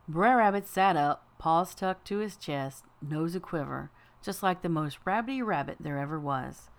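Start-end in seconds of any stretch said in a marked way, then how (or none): none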